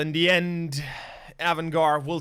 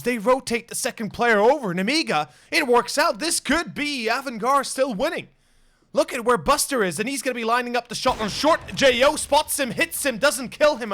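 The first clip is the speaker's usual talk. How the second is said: fast, loud, high hype call; esports caster; 6 semitones higher, 3 dB louder than usual. Real, not acted. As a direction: shout the clutch like a caster. Clutch round live cast.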